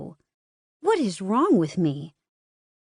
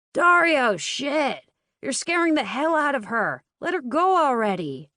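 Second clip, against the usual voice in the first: exasperated shout; 6 semitones higher than usual. Acted. Twin yelling at brother.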